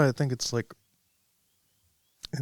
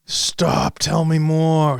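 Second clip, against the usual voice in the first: drawn-out, louder and higher eagerness; eager shock; 4 semitones higher, 5 dB louder, much slower than usual. Real, not acted. Listener begs for more of the ashes story, eager shock. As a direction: stretch it out, hungry for the gossip. Eager reaction after a gasp.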